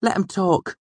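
high-pitched, mildly defiant retort; hint of defiance. Smith's prentice answering his scolder, hint of defiance. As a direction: push back a little. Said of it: "he mumbled with a hint of defiance".

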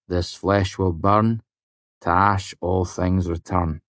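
slow, solemn chant of a ritual verse; sombre intoning. A narrator's reading. Willem reciting a holy verse to his friends, slow and solemn. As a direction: intone it slowly like a rite. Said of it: "Willem intoned in a sombre voice".

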